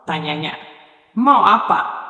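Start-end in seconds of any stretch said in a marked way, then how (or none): none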